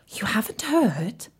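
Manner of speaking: conspiratorial whisper. Shown as a demonstration. Narrator whispering a secret to the child. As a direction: hush it like a secret. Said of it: "unvoiced whisper".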